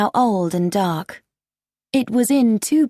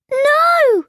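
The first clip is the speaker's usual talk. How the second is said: loud, very high, long cry; horrified cry; 5 dB louder than usual. A narrator's reading. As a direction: cry out in horror. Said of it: "cried Jenny".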